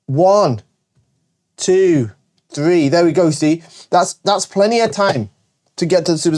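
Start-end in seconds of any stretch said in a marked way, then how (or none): 0.1-2.9 slow counting demo. Shown as a demonstration. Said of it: "let's press, let's try".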